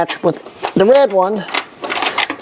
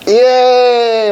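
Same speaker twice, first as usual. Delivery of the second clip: mock cheer; 7 semitones higher, 5 dB louder than usual. Mock cheer at assigning cable work.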